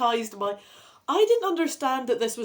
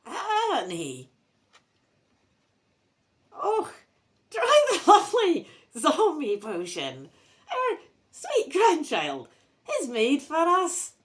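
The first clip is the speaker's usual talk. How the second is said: high, loud, slow Scottish zombie voice; drawn-out zombie wail with a Scottish accent; zombie granny; 7 semitones higher, 5 dB louder, slower than usual. Acted. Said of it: "do a very zombie-sounding voice".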